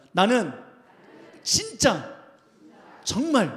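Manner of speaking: 나는, 진짜, 정말 each loud, with pauses between; emphatisches Vorsprechen. Shown as a demonstration. Leads congregation in emphatic repetition.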